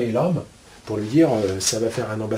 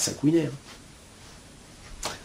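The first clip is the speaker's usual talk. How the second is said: whispered aside; 10 dB quieter, much slower than usual. Real, not acted. Aside about the squeaking conflict.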